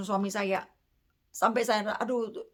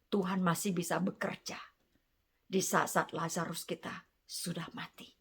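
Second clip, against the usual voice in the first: dramatic whisper; 5 semitones lower, 8 dB quieter than usual. Acted. Preacher drops to dramatic hush.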